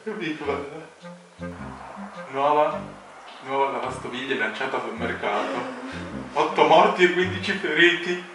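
ordinary delivery

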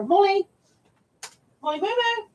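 high sing-song call to the dog. Real, not acted. Calling the dog upstairs.

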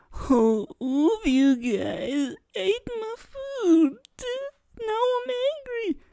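high, slow, whiny fake anger that falls flat; mock bad actor anger. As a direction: play a terrible actor faking rage, squeaky and weak. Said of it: "Someone ate your food at home. You're mad. Go".

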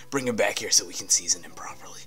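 whispered, sinister NPC line; creepy whisper. Retold or imitated. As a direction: hiss it like a creepy secret. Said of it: "He's like".

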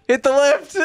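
high, excited call through laughter; laughing urgency. Real, not acted. Player urging partner on mid-flight, laughing.

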